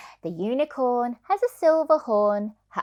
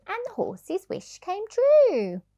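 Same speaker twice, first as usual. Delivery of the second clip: magical high note; 11 semitones higher than usual. Acted. Wish comes true, magic moment.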